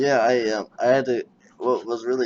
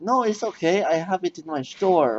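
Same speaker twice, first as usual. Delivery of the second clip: mock clerk; 7 semitones higher than usual. Retold clerk.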